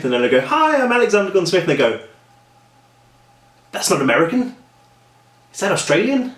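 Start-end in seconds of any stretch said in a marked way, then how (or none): 0.4-1.6 high-pitched introduction; chirpy self-imitation. Acts out his own video-call greeting. 3.7-6.3 puzzled listeners. Retold or imitated. Acts out puzzled listeners on call.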